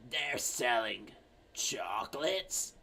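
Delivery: put-on soft, low, slow old-lady question. Old lady finally hearing her daughter, slow soft old voice. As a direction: echo it quietly, drawing out each word. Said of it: "the very old lady comes in".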